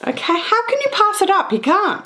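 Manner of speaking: high, quick and thrilled; excited gushing. Real, not acted. Vlogger to viewers, gushing over a scarf: high and excited. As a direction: squeal it like you can't resist.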